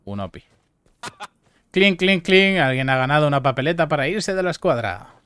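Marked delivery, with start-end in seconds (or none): 1.7-2.6 imitated clink. Imitates sound, high and loud.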